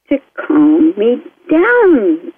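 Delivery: very slow, swooping stretched words; exaggerated, lingering slow delivery; drawn-out calming. Shown as a demonstration. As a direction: draw it right out as if winding down. Acting out being calmed down.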